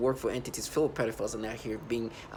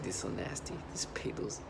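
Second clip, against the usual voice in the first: softer, lower mocking voice, last word whispered; quiet mock sneer; 5 semitones lower, 9 dB quieter than usual. Mocking the voices she hears, softly sneering.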